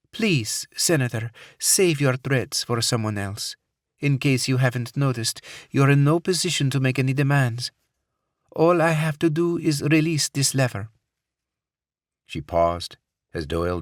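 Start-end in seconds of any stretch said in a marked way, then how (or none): 0.1-10.9 put-on Middle Eastern accent. A narrator's reading. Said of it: "tinged with a Middle Eastern accent".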